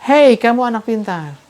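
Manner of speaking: high shout coming back from afar; echo shout. Retold or imitated. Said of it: "dari kejauhan dengan suara yang lebih keras lagi".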